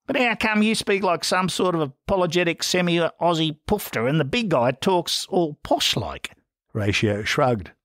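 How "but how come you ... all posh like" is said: higher, streetwise Aussie bloke; a rough larrikin questioning his brothers. A narrator's reading. [name] quizzing [name], a higher rough Aussie voice.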